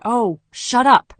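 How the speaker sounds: slow, loud, dismissive shut up; sharp annoyed doctor; irritated snap. A narrator's reading. Doctor silences rambling patient.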